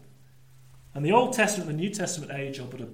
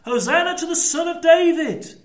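high, louder jubilant acclamation; cheering crowd shout; 10 semitones higher, 4 dB louder than usual. Acted. Big crowd from Jericho hailing the Messiah, cheering and shouting. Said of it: "all cheering and shouting".

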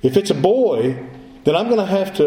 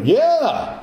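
high, drawn-out emphatic "yeah"; emphatic yes; 10 semitones higher than usual. Acted. Preacher voicing the kinsman's answer to Boaz, emphatic and high. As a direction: jump up in pitch on an eager yes. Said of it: "It's an emphatic statement".

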